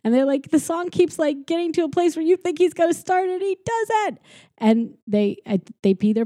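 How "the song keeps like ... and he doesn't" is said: put-on high, fretful kid voice; high anxious voice. Scared kids describing the unending song, high fretting. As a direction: fret it up high.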